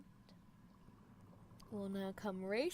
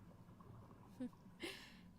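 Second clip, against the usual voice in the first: giggle; 10 semitones higher, 12 dB quieter than usual. Acted. Giggle after sweetest pill.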